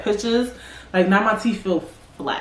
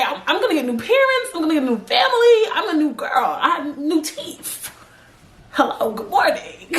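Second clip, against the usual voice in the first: put-on excited high new-girl voice; 7 semitones higher than usual. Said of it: "How you say?".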